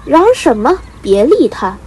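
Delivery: loud, sharp fatherly rebuke; stern scolding shout. A narrator's reading. Father bird silencing his complaining chick, stern shout. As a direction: cut the noise off with a loud scold. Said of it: "爸爸却喝道".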